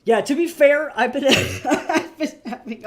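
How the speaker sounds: high and loud, laughing through the words. Real, not acted. Streamer laughing to chat about a hard decade, laughing. Laughs while admitting struggle.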